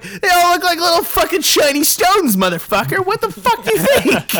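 loud, very high comic rant; hammed-up yelling in mock outrage; exasperated retort. Customer asked which diamond he likes, exasperated mock yell.

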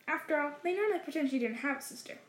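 usual speaking voice

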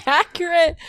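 real laughter breaking into the words; laughing hard. Real, not acted. Reacting to her friend's joke, laughing hard. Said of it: "I'm crying right now".

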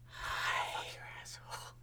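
breathy whisper, barely audible; whispery voice. Acted. Upside-down girl greets the dreamer in a whisper. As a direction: whisper it sweetly like a creepy girl. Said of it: "in a whispery voice".

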